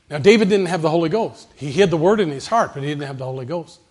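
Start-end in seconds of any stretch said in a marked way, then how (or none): 0.1-2.7 louder preaching push; preaching emphasis. Real, not acted. Preacher booms to the congregation about David. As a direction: hammer the point home to the church.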